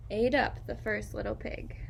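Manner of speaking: usual speaking voice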